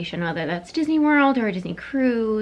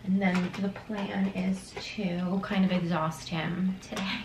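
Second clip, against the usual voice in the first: slow hushed unvoiced aside; whispering; 10 dB quieter, slower than usual. Real, not acted. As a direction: whisper it conspiratorially. Whispered plan near sleeping child.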